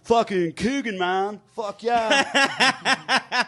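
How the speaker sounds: lightly voiced San Diego bro, laughing at the end; a hint of a Southern California stoner; Southern California accent. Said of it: "this is how he sounded".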